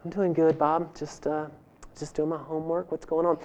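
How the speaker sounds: put-on soft, casual girl on the phone; a hint of a teenage girl doing homework; lightly voiced girl. Girl answering a boy's phone call, lightly voiced.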